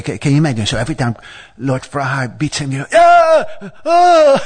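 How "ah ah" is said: high, loud "ah!" outburst; acted crowd cry. Demigods watching Lord Varaha beat the demon, high excited cry. Acts out demigods cheering a hit.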